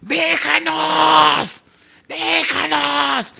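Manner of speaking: high, drawn-out demon screech; shrieking cry. Acted. Preacher showing how the exclamation really sounded, demonic shriek. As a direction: screech each cry, holding it long and high. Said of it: "Un grito de chillido".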